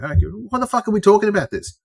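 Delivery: high, hurried, fed-up voice; high-pitched scolding without extra volume; retold exasperated retort. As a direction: shut the chat down in mock exasperation. Fed-up retort ending workmates' questions.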